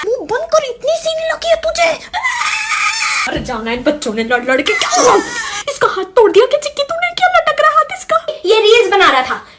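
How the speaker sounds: high, exasperated mum voice, partly shouting; scolding mother. Acted. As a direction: play the mother fed up with the kids. Mother scolds the fighting kids. (झल्लाई हुई mummy, ऊँची आवाज़ में)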